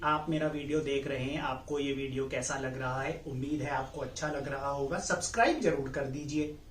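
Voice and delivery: low, soft, flat reading with no rise or fall; flat monotone. Shown as a demonstration. Presenter showing how a boring flat video intro sounds. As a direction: drone it like a bored robot. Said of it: "अगर मैं एक flat tone में बोलूंगा".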